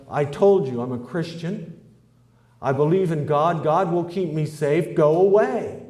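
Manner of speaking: builds from firm to a high, loud shout; getting angrier until he shouts go away; yelling out. Acted. Man shouting from his window at the sheriff, building to loud. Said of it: "he yelled out".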